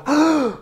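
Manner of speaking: sharp high mock gasp of shock; imitated audience gasp. Retelling the theater crowd's reaction, a sharp mock gasp. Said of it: "I never heard a theater just go".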